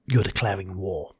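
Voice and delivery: normal talking voice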